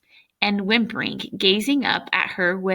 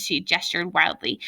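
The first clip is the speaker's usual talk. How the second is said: ordinary delivery, nothing marked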